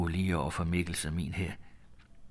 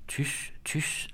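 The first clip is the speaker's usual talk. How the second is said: whisper; 7 semitones higher, 5 dB quieter than usual. Acted. Daughter hushes over dead man.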